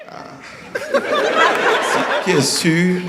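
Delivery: mock embarrassed neighbour; awkward neighbour. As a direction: grope for something to say about an ugly baby. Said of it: "ils font".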